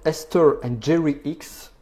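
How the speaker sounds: careful, slow English pronunciation; English accent attempt. Real, not acted. Citing book authors to viewers, in a practised English accent. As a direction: pronounce the names slowly with an English accent. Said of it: "je travaille mon accent anglais".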